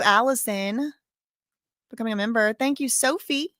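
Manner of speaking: ordinary delivery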